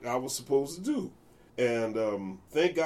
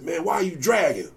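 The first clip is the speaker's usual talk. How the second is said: higher, louder scolding question; mock accusation; 7 semitones higher, 5 dB louder than usual. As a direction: round on the young bassist. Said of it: "They said".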